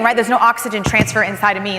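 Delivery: normal talking voice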